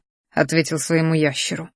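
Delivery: ordinary delivery, nothing marked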